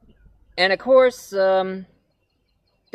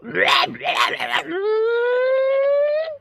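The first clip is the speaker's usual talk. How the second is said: very high shrieking cackle; wild cartoon laugh. Devil character laugh.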